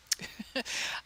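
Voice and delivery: soft, breath-only chuckle; laugh. Real, not acted. Laughs after being asked about PayPal.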